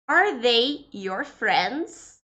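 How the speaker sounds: slow, careful English example; slow model sentence. Shown as a demonstration. Teacher reads the English example slowly to students. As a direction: spell it out slowly for learners.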